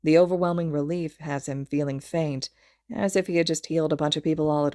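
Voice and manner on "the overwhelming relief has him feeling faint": usual speaking voice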